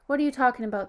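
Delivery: a touch of irritation, pushed loud; angry. A narrator's reading. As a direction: challenge the kids' story. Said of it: "the driver asked angrily".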